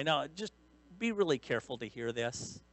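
normal talking voice

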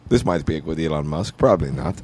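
low, menacing evil mastermind; Bond villain voice. Retold or imitated. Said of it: "do general Bond villain".

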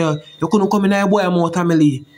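mocking taunt. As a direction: needle him like the mocking neighbours. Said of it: "They must say".

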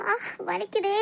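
sudden stab of pain, gasped out; cry of pain. Acted. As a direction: wince it out, hurting. Sudden stomach pain in scene.

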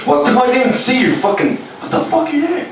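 high, startled shriek at someone sneaking up. Retold or imitated. Person who didn't hear him coming, shrieking in shock. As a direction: shriek in fright at the figure behind you.

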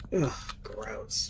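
hushed, breathy "ugh, gross"; quiet grossed-out whisper; whispered disgust. Real, not acted. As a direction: mutter it under your breath in disgust.

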